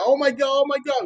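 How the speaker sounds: very high, frantic retold cry; mock screaming. Fisherman spotting the dolphin behind the boat, mock screaming. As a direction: squeal it out in mock alarm. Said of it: "he started screaming".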